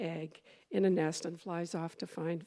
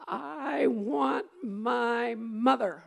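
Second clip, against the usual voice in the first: slow, drawn-out and louder, leaning on want; exclaimed demand; 4 semitones higher, 5 dB louder, much slower than usual. A narrator's reading. Baby bird in the steam shovel crying out, slow and loud. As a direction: wail it out long, like a lost chick. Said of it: "Exclamation point".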